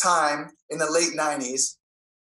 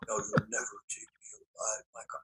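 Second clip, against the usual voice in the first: breathy hushed threat, much lower and softer; intense whisper; 7 semitones lower, 12 dB quieter than usual. Retold or imitated. Hero growling a threat at his foe, hushed. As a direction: mutter the threat barely audible.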